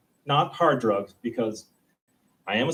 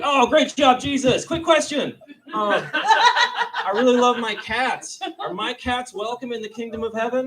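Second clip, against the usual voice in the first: high, fawning, earnest question; gushing fan asking Jesus about cats; high eager voice; eager follower; 11 semitones higher, 4 dB louder than usual. Retold or imitated. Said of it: "somebody was like".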